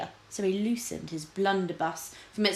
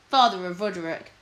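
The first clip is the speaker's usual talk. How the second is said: usual speaking voice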